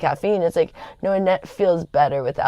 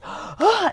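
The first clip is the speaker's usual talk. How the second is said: sharp high gasping for air; mock gasp. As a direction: gasp as if choking on smoke. Feeling like dying after bong hit.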